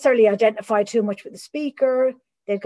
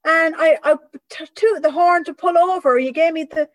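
loud, high Irish complaint; an old Irish man grumbling at her; old Irish guy; 8 semitones higher, 6 dB louder than usual. Retold or imitated. Old Irish guy tells her she gave him the bird, loud and high in an Irish accent. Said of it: "these old Irish guys would go".

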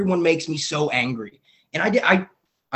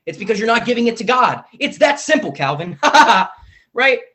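higher and louder, preaching at himself; emphatic self-scolding; 8 semitones higher, 8 dB louder than usual. Real, not acted. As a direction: hammer it home to yourself, half laughing.

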